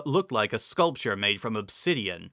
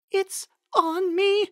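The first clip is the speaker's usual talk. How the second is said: high, slow, frightened voice; a pony feeling something unseen on her, scared; 3 dB louder, much slower than usual. Acted. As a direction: freeze and say it in terror. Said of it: "in a very quiet, frightened voice".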